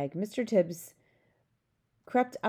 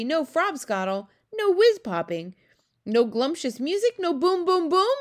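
loud, high disbelief, building; dismayed cry; 10 semitones higher, 7 dB louder than usual. A narrator's reading. Giant learning what is forbidden, dismayed crying out. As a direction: climb higher and louder with each question. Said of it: "cried the B F G".

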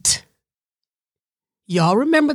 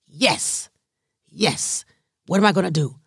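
ordinary talk, nothing marked

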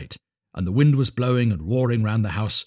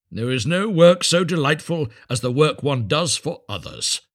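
higher, louder, pompous maxim; sanctimonious miller; 6 semitones higher, 5 dB louder than usual. A narrator's reading. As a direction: pronounce it grandly.

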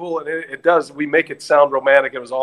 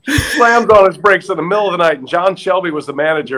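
normal talking voice

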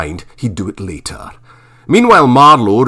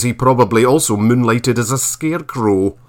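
usual speaking voice

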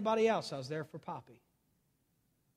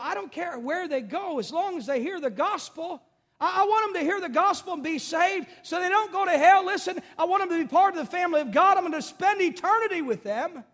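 loud, high pulpit push; loud impassioned sermon voice; fiery preaching; 11 semitones higher, 9 dB louder than usual. Acted. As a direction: drive the point home hard. Preaching at full force.

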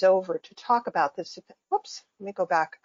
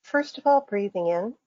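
guided meditation. Acted. Faculty member guiding listeners through breathing and imagery, calm meditation voice. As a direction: lead listeners gently into imagery, phrase by phrase.

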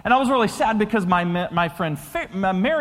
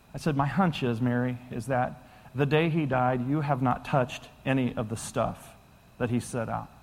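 low, soft and tender; gentle confrontation; 8 semitones lower, 6 dB quieter than usual. Retold or imitated. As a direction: break hard news softly, from love. Retelling a tender, hard conversation.

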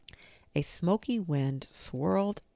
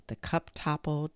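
ordinary delivery, nothing marked

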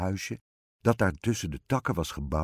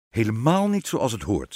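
loud, slightly higher king voice; emphatic royal refusal; disapproving king; 4 semitones higher, 7 dB louder than usual. A narrator's reading. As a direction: pronounce it improper like a storybook king. King indignantly rejects the plan.

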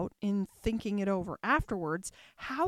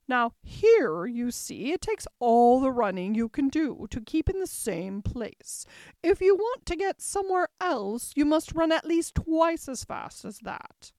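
loud, emphatic queen rising higher; imperious queen, explaining; 3 semitones higher, 7 dB louder than usual. A narrator's reading. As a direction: lecture loudly as a commanding queen. Narrator voices the Red Queen.

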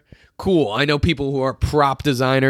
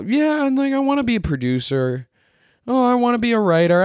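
mocking wannabe; 11 semitones higher than usual. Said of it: "are all".

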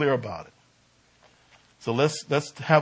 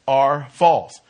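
"are false" hit hard and loud; preacher hammering "are false"; stress on are false; 8 dB louder than usual. Shown as a demonstration. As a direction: punch "are false" with full weight. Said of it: "So I stress the words".

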